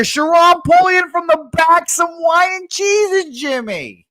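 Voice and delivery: high, loud, excited race call; race announcer. Acted. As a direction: call it like a horse race finish.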